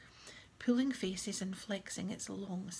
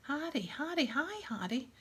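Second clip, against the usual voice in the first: high, swooping excited call; eager hailing; 6 semitones higher, 4 dB louder than usual. A narrator's reading. Younger boy greeting his idol, eager and high. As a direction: call out to your hero eagerly.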